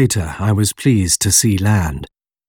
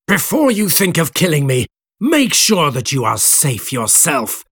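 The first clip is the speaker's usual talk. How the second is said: angry warning; 5 semitones higher than usual. A narrator's reading. Creator threatens back after screaming.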